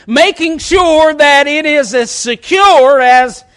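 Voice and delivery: loud, high, drawn-out pulpit cry; ringing, stretched-out exhortation; full-force preaching. Real, not acted. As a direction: preach it slowly at full pitch.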